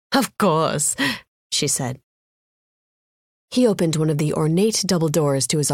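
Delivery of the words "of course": put-on short reply in heavy Indian accent; thick Indian accent. A narrator's reading. Winner accepting the manager's apology, thick Indian accent. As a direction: agree in strong Indian English. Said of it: "in a thick Indian accent".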